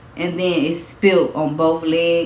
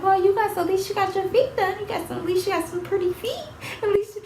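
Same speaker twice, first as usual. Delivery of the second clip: much higher, softer cooing voice; imitating onlookers; 13 semitones higher, 6 dB quieter, faster than usual. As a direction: coo it like people admiring her feet.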